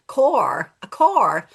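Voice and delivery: loud demo of Scottish car; car with the r sounded, Scottish style; Scottish accent. Shown as a demonstration. Teacher demonstrates an accent for phonics, Scottish accent. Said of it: "Put a Scottish accent".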